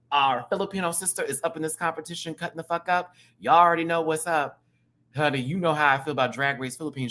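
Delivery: usual speaking voice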